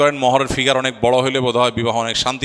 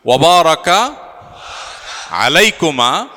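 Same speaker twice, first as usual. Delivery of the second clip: slow, raised call with rising ends; leading a prayer; 4 semitones higher, 4 dB louder, slower than usual. Real, not acted. Officiant leading all the guests in the blessing, raised call-and-repeat. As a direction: call it out louder, piece by piece, for echoing.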